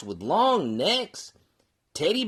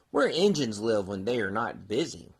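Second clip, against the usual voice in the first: plain reading voice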